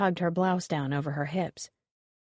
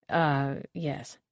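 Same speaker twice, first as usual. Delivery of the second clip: distracted; 4 semitones lower than usual. A narrator's reading. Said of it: "Robeson said distractedly".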